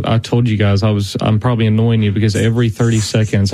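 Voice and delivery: usual speaking voice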